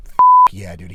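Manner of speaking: emotional cheer. Retold or imitated. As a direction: blurt it out, voice breaking with feeling. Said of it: "his voice was shaking".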